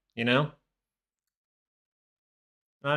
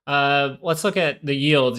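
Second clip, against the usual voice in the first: ordinary delivery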